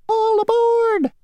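very high, slow tiny call; mosquito calling out. Acted. Mosquito calling passengers aboard, high drawn-out call. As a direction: announce it like a proud little ferryman. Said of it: "called the mosquito".

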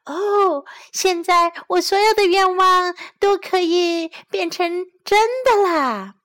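high, loud, squeaky delight; 兴奋地尖叫; excited cry. A narrator's reading. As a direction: squeal it like a thrilled mouse. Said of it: "她叫道".

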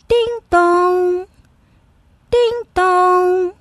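high, sing-song ding-dong; doorbell chime. A narrator's reading. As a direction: imitate a ringing doorbell. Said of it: "一阵门铃声".

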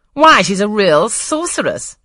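put-on young Frederick Lynn thrilled by the gipsy, crying out slowly; excited cry. A narrator's reading. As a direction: savour the word sorceress with glee. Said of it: "cried Frederick Lynn".

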